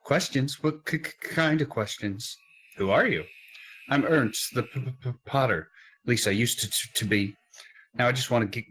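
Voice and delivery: stammering scared potter. Acted. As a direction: stammer like a terrified villager. Stammering frightened potter voiced.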